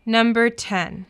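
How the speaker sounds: usual speaking voice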